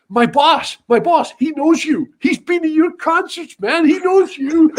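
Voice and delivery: high, eager gushing; a cop telling a piper his boss is a fan; mock excited cop. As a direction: gush like a starstruck fan.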